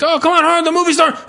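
high rushed usher voice; mock eager usher; hurried usher. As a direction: hurry them in, bright and pushy. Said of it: "The guy's like".

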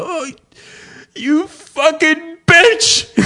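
high, drawn-out fake choked-up curse; mock emotional outburst. Man overcome watching Forrest Gump, mock anguish. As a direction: wail it slowly, hammed-up.

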